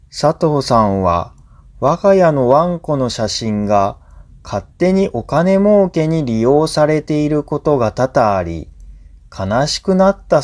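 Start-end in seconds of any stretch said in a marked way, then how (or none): none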